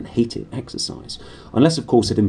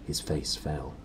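plain reading voice

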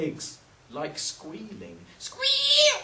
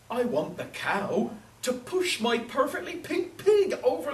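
put-on higher-pitched old woman, pleading; exasperated old woman; 9 semitones higher, 4 dB louder than usual. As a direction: build from scolding to a loud, high plea.

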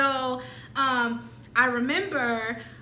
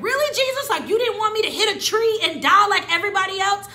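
much higher and louder sarcastic complaint to Jesus; mock outrage; 9 semitones higher, 9 dB louder than usual. After the car crash, complaining to Jesus, sarcastic and loud. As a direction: snap at Jesus in disbelief.

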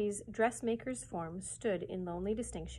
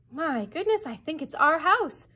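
croaked; 7 semitones higher, 10 dB louder than usual. A narrator's reading. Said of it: "croaked Rush".

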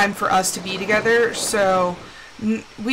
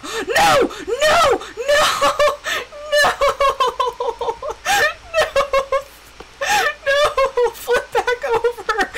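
frantic shouts of no; 10 semitones higher than usual. Real, not acted. Watching the sled flip, panicked high shouts. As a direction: yell no over and over in panic. Sled flips, repeated cries.

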